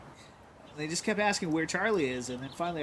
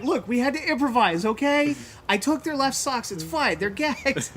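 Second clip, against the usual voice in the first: raised, loud self-justification; defensive mock argument; 9 semitones higher, 7 dB louder than usual. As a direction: argue back loudly, insisting it's fine. Defends actions in retold argument.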